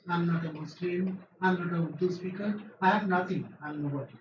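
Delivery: normal talking voice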